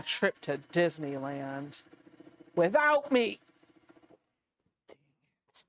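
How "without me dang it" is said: higher, punched complaint; playfully put-out outburst; mock indignation. Real, not acted. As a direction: protest being left out, pitched up. Mock complaint at being left out.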